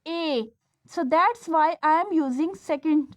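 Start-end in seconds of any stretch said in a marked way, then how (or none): 0.1-0.5 ए drawn out very slowly; sounding out the vowel. Shown as a demonstration. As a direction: hold ए long so students hear the vowel. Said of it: "देखिए P के बाद कैसी आवाज़ है".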